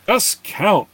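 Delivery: high, loud screeched order; screamed command. A narrator's reading. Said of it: "Enniver screamed".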